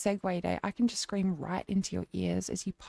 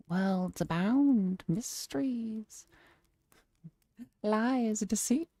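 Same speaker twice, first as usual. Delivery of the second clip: dramatic lore narration; slower than usual. Pretend. Streamer explaining a magical box to viewers, hushed mystical narration. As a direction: draw out each mysterious word. Reads out mock fantasy lore.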